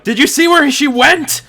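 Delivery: high, loud call down the stairs; urgent in-character shout. Acted. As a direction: shout it down to the guards below.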